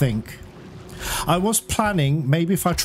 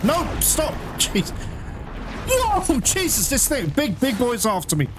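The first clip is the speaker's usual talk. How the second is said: higher panicked cries as the boss chases you; real panic; 7 semitones higher than usual. Real, not acted. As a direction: blurt it out in genuine panic. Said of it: "I am really panicked".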